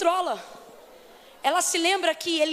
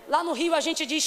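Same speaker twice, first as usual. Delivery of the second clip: normal talking voice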